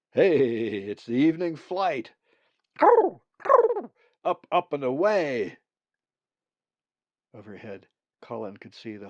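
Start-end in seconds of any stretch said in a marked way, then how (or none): 0.1-5.6 loud, higher, excited call to the flock; excited shout. Acted. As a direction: shout it out as they take off. Zack spots the flock overhead.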